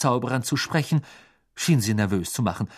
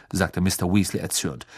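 usual speaking voice

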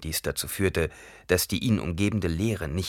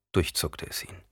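ordinary delivery

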